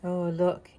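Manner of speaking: ordinary delivery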